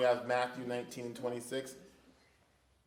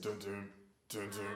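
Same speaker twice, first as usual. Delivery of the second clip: ominous music hum; 8 semitones lower, 14 dB quieter than usual. Shown as a demonstration. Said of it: "The music what?".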